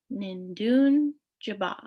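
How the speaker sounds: Nindun Jiba said slowly, each syllable clear; slow careful pronunciation. Shown as a demonstration. Teacher repeats the phrase slowly for students. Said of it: "the full, uh, pronunciation is".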